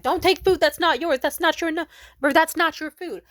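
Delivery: loud high scolding. Retold or imitated. Cashier scolding the customer he blamed, loud high scolding. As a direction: tell her off hard for taking it.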